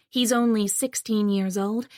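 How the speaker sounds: ordinary delivery, nothing marked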